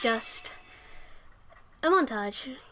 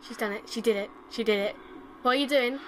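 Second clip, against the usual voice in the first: ordinary talk, nothing marked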